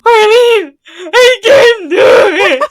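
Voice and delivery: loud retold wail, pitch way up. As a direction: sob it out, shouting through tears. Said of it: "fucking wailing".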